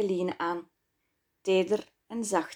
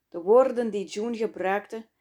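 ordinary delivery

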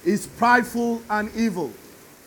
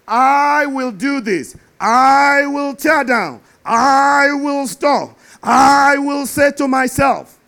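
slow, growing louder, each I punched, ending in a shout; building stress on I; 3 semitones higher, 5 dB louder, slower than usual. Shown as a demonstration. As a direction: hammer every I harder and slower until you shout. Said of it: "Six times he said, "I".

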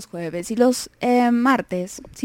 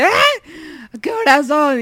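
startled jolt out of sleep, a bit louder; panicked wake-up; 4 semitones higher, 5 dB louder than usual. Retold or imitated. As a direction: she wakes late, realises the time, startled. Waking up late in a panic.

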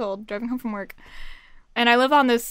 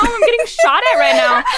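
high, loud mock-panicked cry to mom; retold alarmed call; 12 semitones higher, 8 dB louder than usual. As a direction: act out a frantic call home, half laughing. Retold call to mom under fire.

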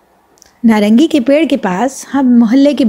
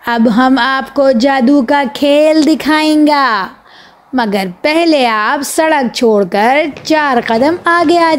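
slow, higher showman's spiel; a boy playing a hawker-style magician, slow and higher; street magician patter; 4 semitones higher, slower than usual. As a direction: drum up the crowd like a roadside conjuror. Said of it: "بچوں سے بولے".

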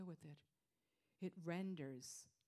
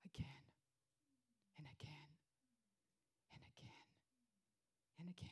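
fading whisper; 2 semitones lower, 9 dB quieter, faster than usual. Real, not acted. Fading repetition.

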